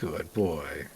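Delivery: put-on low, soft good boy; soft pet praise. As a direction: coo it gently to a pet.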